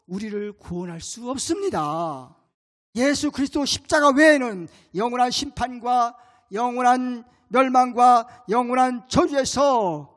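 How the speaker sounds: loud, slow, pounding proclamation; full-force preaching. Acted. Pastor proclaiming to congregation, loud and slow. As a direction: preach it out, hammering each word.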